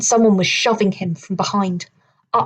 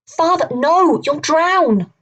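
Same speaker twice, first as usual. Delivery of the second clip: high, louder cry of alarm; desperate cry; 8 semitones higher, 4 dB louder, slower than usual. Acted. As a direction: call out in panic to stop father. Boy sees father about to dive.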